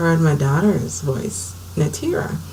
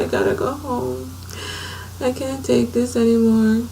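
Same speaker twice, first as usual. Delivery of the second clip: high, softer tearful pleading; crying plea; 6 semitones higher, 5 dB quieter than usual. Retold or imitated. Blind mother retells begging in church, tearful high plea. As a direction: plead to go home as if in tears. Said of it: "everybody started to cry".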